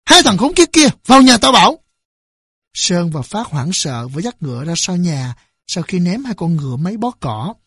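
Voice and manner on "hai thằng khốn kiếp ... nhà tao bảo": angry, high, loud yell; quát out loud, high and harsh-tempered; loud shout. A narrator's reading. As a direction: bellow it across the yard as a furious father. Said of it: "quát to".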